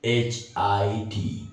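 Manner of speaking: R U N spelt out slowly, letter by letter; slow spelling. Shown as a demonstration. Teacher spells run slowly for students.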